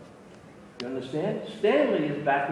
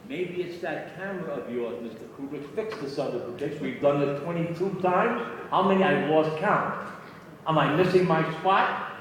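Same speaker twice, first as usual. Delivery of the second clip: rising fury; 3 semitones higher, 5 dB louder than usual. Retold or imitated. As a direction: let the anger climb line by line until it bursts. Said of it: "He was screaming at that moment".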